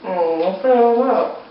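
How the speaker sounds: usual speaking voice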